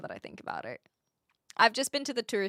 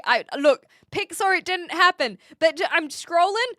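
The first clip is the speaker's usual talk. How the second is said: usual speaking voice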